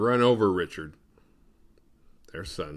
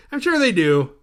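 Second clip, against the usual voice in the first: raised, loud mock concession; exasperated retort; 10 semitones higher, 11 dB louder than usual. Real, not acted. Answering imagined hate mail, loud exasperation. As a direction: throw it back loudly at imagined critics.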